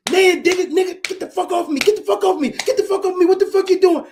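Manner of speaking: loud high yelling; retold yelling. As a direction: yell it like someone getting beaten up. Richard yelling while being hit.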